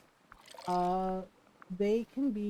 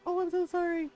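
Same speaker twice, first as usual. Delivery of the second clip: high apologetic; 9 semitones higher than usual. Retold or imitated. Friend apologizing.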